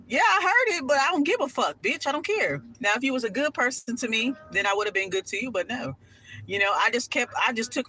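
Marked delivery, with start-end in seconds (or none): none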